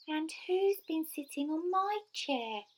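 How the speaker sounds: very high little bear voice; squeaky Baby Bear. A narrator's reading. Baby Bear crying over his chair, squeaky. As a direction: squeak it out, small and upset. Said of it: "in his squeaky little voice".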